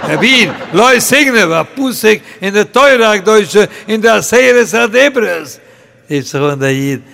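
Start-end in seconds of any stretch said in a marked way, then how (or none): 0.1-5.6 loud, high, acted indignation; outraged scolding. Orchard owner rebuking a thief from the Torah, outraged scolding. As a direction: hammer out the rebuke, louder and higher.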